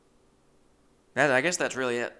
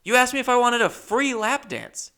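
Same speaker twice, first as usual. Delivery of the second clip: loud and high, incredulous protest; raised voice; 12 semitones higher, 7 dB louder than usual. Retold or imitated. Comic raising his voice at the stripper in the club. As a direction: yell it in disbelief at being charged. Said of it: "I'm raising my voice now in the strip club".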